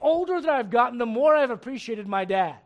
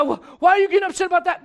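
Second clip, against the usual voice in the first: high, loud, fast defensive whine; defensive teen; 6 semitones higher, 7 dB louder, faster than usual. Acted. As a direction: rush it out, offended. Kid argues with mom.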